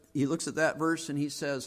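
normal talking voice

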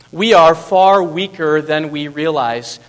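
slow, loud, each word weighted. Shown as a demonstration. Preacher repeating the lesson to the church, slow and loud. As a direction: land the point on the room. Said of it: "repeated key sentence".

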